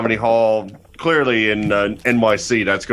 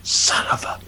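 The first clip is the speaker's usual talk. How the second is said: whisper. Pretend. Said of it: "she's just like".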